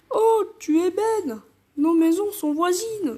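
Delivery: goofy father voice; parent voice. Acted. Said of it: "les darons, je vais faire des voix comme ça".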